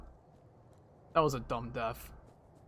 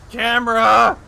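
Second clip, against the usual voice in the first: frustrated shout; 7 semitones higher, 9 dB louder, slower than usual. Real, not acted. Yelling at game camera.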